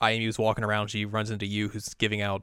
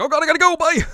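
high, loud frantic exit; panicked shout; 12 dB louder, faster than usual. Acted. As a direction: yelp it in a panic. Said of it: "and she's like".

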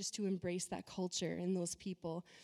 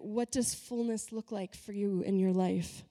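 ordinary talk, nothing marked

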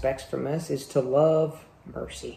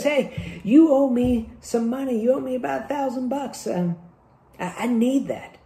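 high-pitched mock creditor; pushy demand; 8 semitones higher than usual. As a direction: press lightly for the money, voice raised in pitch. Acted creditor demanding his money.

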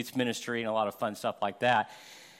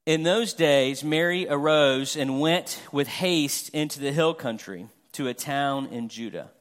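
a measured, raised reading voice for scripture; 4 semitones higher, 3 dB louder than usual. Acted. Pastor reading Luke aloud to the congregation, solemn and full-voiced. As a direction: read the passage to the congregation with weight.

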